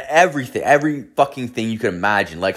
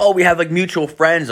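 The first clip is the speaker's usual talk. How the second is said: higher-pitched imitation of a girl; lightly voiced chatty girl; girl's explanation; 7 semitones higher than usual. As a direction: act out her reply pitched up.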